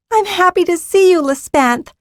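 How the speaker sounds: put-on bright, high little girl; high eager child greeting her dragon; young girl voice.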